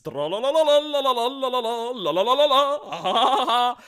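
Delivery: trollolol chant laugh. Pretend.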